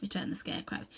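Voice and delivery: usual speaking voice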